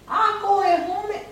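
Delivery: Ako e home said high and quick, all in one go; high and fast. Shown as a demonstration. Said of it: "tout le monde qui me dit". («Ako e home» dit vite et aigu)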